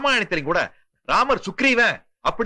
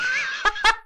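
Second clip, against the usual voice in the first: villain's laugh; 13 semitones higher than usual. Acted. Kidnapper laughs at captive.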